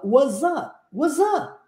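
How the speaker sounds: "What's up?" with S turned to Z and no T, like wuz-a; z-version what's up. Shown as a demonstration. Teacher shows a second casual pronunciation. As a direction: buzz the S into a Z, drop the T. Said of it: "transforma o S em Z e tira o T".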